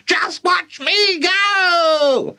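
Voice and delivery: very high, slow shout; triumphant screech. Acted. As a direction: crow it out. Grandma calling from above.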